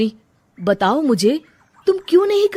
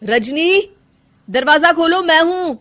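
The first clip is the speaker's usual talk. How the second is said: calling through door; 7 semitones higher, 6 dB louder than usual. Acted. Husband calling through closed door.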